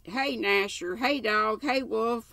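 narrated holler, urging the hounds on. A narrator's reading. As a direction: holler for the dogs, pitch climbing. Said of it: "hollered him".

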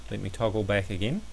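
normal talking voice

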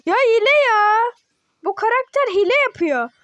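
high, loud outraged protest; fuming that the character cheats; indignant complaint. Real, not acted.

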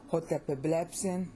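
hotepeblepsin with the weight on "blep"; stress on BLEP. Shown as a demonstration. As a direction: lean on blep in hotepeblepsin, keep "epe" unstressed. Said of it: "stress is on blep".

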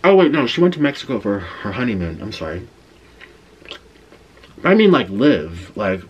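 chewing between words; muffled by pizza bite; talking with mouth full. Real, not acted. Seen 3: bit pizza at -7; cheeks bulge, chewing until ~6.6, then clear.

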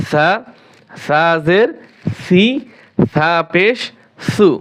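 ordinary talk, nothing marked

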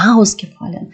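Ausgefallen with the first syllable AUS stressed; AUS-gefallen, prefix accented. Shown as a demonstration. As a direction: punch Aus in Ausgefallen, then fall away. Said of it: "він вимовляється з наголосом".

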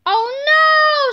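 high, loud cry of alarm; scream. A narrator's reading. George seeing revenge coming, screaming. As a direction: scream it out, stretching the last word. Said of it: "screamed George".

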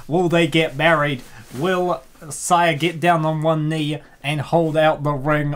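usual speaking voice

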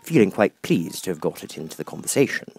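usual speaking voice

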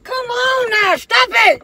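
very high, loud stop it; panicked shout at daughter; shouted plea. Real, not acted. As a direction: yell to make her stop. Daughter threatens to drop phone.

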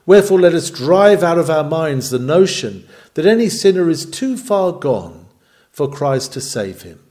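ordinary talk, nothing marked

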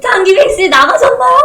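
high, loud laughing question. Real, not acted. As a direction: laugh through the words. Laughing after success.

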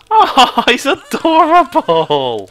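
very high, slow gushing coo. Real, not acted. Gamer gushing at a cute Cyclops, cooing. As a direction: coo over him like a puppy.

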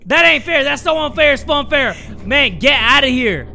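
high, loud outraged protest; startled shouting. Real, not acted. Player protesting the enemy's unfair teleport, shouting. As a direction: yell in alarm, then keep protesting loudly. Enemy suddenly appears in front.

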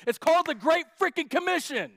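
high, loud punchline; emphatic shout. Real, not acted. Preacher punching the joke to the crowd, loud. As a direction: hit it hard for the laugh.

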